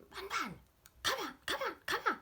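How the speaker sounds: high-pitched calls to a dog; calling dogs. Retold or imitated. Boys calling their dogs by name, high-pitched calling. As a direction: call the dog back, high and urgent. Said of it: "They started calling dogs".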